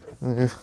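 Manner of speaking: brief, small laugh; quick little laugh after an admission; short laugh. Real, not acted. Awkward pause after painful admission.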